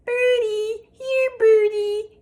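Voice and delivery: put-on high, loud little-boy call; toddler calling. Toddler calling pigeons in the park, high and loud. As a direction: coax the birds like a small child. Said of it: "he called".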